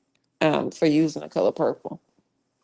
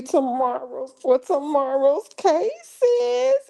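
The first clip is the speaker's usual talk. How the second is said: much higher, bouncy farewell calls; sing-song goodbye; 10 semitones higher, much slower than usual. Real, not acted. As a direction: sing the heys out like a cheery wave.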